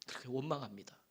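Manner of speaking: usual speaking voice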